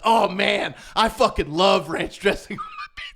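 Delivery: loud, slow, laughing gush; excited, laughing. Retold or imitated. Singer loudly raving about ranch on pizza. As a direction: relish every word with a laugh. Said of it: "He's like".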